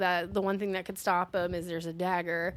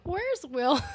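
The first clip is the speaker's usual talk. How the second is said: put-on old grandma voice; 5 semitones higher than usual. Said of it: "she's like".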